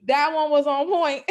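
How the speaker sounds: laughing through the words; amused, laughing out loud; high laughing reply to the accent joke. Real, not acted.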